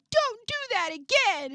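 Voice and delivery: put-on high, loud child's scolding; imitated toddler yell. Three-year-old to mom at Target, imitated yell. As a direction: mimic a small kid yelling at mom. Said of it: "He yelled at his mother".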